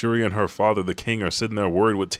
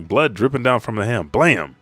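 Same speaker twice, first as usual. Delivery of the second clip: usual speaking voice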